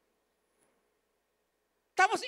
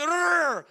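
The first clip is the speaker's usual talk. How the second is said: loud growling roar; lion roar; 2 semitones higher, 6 dB louder than usual. Retold or imitated. Big lion roars face to face at young lion, loud growl. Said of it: "sabi ng leon".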